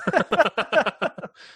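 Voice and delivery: loud high laugh; real burst of laughter. Real, not acted. Laughing hard at the episode's final joke. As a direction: crack up at the punchline.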